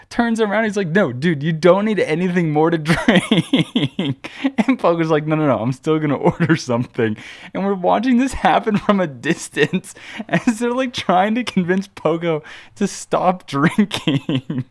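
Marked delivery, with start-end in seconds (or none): 0.9-4.3 higher pitch, breaking into laughter; laughing through quote. Real, not acted. Laughs while acting out friend's plea. 5.3-14.6 high-pitched, giggly storytelling; laughing while telling. Real, not acted. Cracking up telling drunk friend story.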